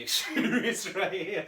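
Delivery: laughing. Real, not acted. Host cracking up at his own story, laughing. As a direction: laugh through the words. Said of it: "this is shocking to you".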